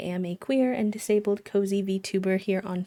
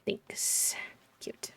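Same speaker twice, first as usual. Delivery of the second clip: whisper; 12 dB quieter than usual. Real, not acted. Whispered aside.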